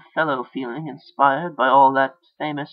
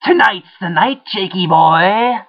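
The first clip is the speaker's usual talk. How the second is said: menacing inner voice; 5 semitones higher, 8 dB louder than usual. A narrator's reading. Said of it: "the voice replied".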